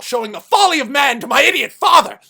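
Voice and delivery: high, loud furious shout; acted god's rage; villain's outburst. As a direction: erupt in contempt for your father. Villain's speech erupts.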